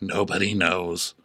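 low, slow, drawn-out voice; Marcos. Acted. Answering how many days it has been, low and slow. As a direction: stretch it out deep and slow. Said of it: "Marcos is like".

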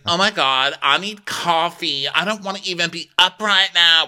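high, loud whiny morning voice; mock a hungover girl who needs coffee; groggy mock girl voice. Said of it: "And she's like".

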